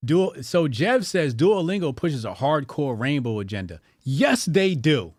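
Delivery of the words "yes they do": emphatic. Real, not acted. Emphatic agreement with chat.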